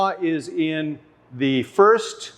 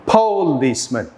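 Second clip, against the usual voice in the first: "Policeman" front-stressed as POH-leece-man; "Policeman" with a long full O, then falling; stress on first syllable; 3 semitones lower than usual. Shown as a demonstration. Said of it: "try each option".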